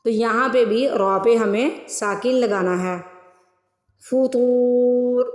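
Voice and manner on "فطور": فطور with a heavy to stretched long, very slow; slow tajweed recitation. Shown as a demonstration. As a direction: draw out the to of فطور. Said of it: "پہلے توا کو stretch کرنا ہے".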